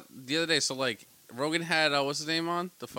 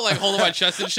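ordinary talk, nothing marked

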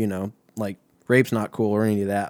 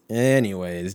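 normal talking voice

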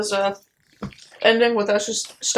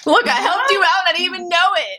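laughing, high and louder; 7 semitones higher, 3 dB louder than usual. Real, not acted. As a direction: laugh through the defence. Laughing defence after joke.

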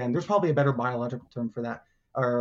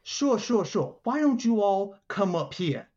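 raised, put-on boss voice; Big Notch; 8 semitones higher than usual. Boss inviting visitors onto his desk, put-on big-guy voice. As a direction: play the big shot inviting them up. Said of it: "you hear this booming voice".

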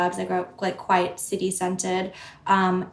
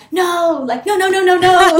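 high, loud string of panicked refusals; acted alarmed refusal; 10 semitones higher, 9 dB louder than usual. Guest acts out hearing an Aussie accent, alarmed refusal. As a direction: recoil loudly, pitch shooting up.

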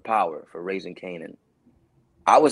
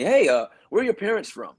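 higher-pitched mock interviewer voice; casting director imitation; 9 semitones higher, 3 dB louder than usual. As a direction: pose it as an offhand casting question. Mimics casting's odd question.

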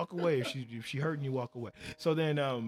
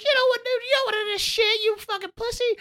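high-pitched, loud imitation of the drunk woman yelling; shrill girl impression; 5 dB louder, faster than usual. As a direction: squeal her comeback way up high and loud. Girl yells back at car.

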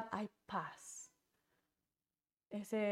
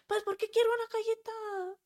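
put-on high pouty child voice, much higher and louder; whiny little girl; 12 semitones higher, 5 dB louder than usual. Little girl demanding a cookie from her parent, high pouty voice. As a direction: play the stubborn kid who wants a cookie.

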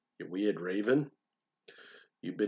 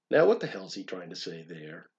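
ordinary talk

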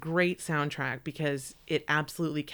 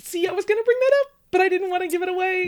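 much higher, louder and fast; excited burst; 11 dB louder, much faster than usual. Real, not acted. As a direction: blurt it out eagerly. Excited interruption about Lost.

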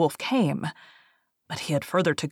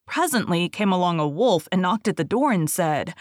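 plain reading voice

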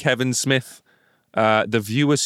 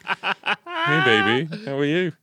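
talking to the dog; 5 semitones higher, much slower than usual. Retold or imitated. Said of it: "maybe I did actually just say that in dog".

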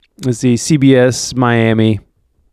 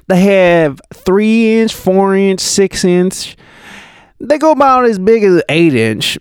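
high, drawn-out southern witness voice; southern accent; 7 semitones higher than usual. Acted. Kofi explaining mortar sizes to reporters, southern accent. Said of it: "I'm just gonna do a southern accent".

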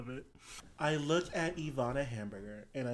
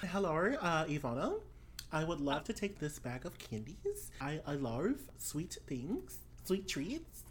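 put-on softer Australian-accented lilt with rising ends; player's character, sweetly polite; 3 semitones higher, 4 dB quieter than usual. Character to Ivana wanting candy, sweetly polite.

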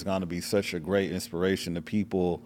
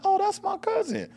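imitating the cousin. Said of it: "She was like".

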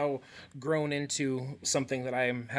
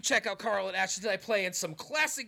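hyped-up announcer; 8 semitones higher, faster than usual. Real, not acted. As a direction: pump up the crowd. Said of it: "All right, calm down".

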